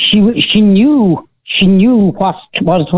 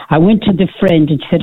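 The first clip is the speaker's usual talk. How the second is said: ordinary talk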